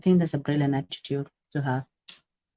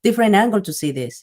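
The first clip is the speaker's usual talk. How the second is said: higher and louder, an aha moment; sudden realization; 5 semitones higher, 7 dB louder than usual. Acted. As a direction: act out suddenly seeing it.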